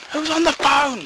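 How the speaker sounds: high-pitched mock call downstairs; shouting. You shout down to ask about the phone, high call. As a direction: call out high to another room. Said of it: "You'll shout and going".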